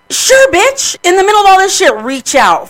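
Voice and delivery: loud, high, sarcastic outrage; indignant retort. Retold or imitated. Griever answering friend's public comment, indignant.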